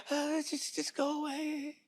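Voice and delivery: high, soft, airy "go away"; a quiet, high shooing-away voice; dismissive brush-off. Retold or imitated. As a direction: wave them off under your breath. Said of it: "we're like".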